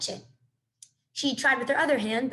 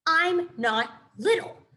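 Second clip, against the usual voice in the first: snapped; 5 semitones higher, 5 dB louder, much slower than usual. A narrator's reading. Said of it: "snapped Thorn under his breath".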